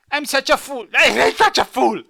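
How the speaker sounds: high, loud goofy cackling voice; Ripperoo. Acted. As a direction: play a giggling loony. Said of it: "imagine her talking a bit like, uh, Ripperoo".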